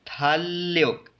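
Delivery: थाल्योक slowly, with one held L; single L sound. Shown as a demonstration. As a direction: hold the joined ल in थाल्योक. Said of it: "एक ही लाम की आवाज़". (थाल्योक, एक ही लंबे लाम से)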